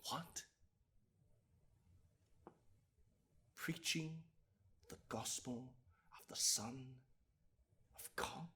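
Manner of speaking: barely audible, with long pauses between phrases; very soft, halting incredulity; hushed disbelief. Acted. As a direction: drop right down and dole the words out in stunned fragments. Courtroom speech, appalled at charge.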